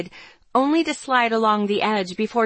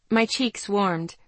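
ordinary delivery, nothing marked

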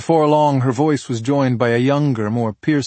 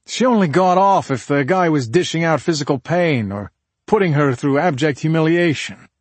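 slightly heated man's character voice, pitched up; higher, judgmental account; emphatic husband; 6 semitones higher, 4 dB louder than usual. Acted. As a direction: tell it with a raised, pointed delivery. Husband reveals victim's preferences.

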